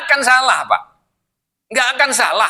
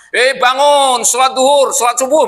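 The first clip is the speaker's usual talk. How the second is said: raised, higher call to rouse sleepers; wake-up call; 6 semitones higher, 4 dB louder than usual. Acted. Preacher acts out waking fifty sleepers for prayer, calling out.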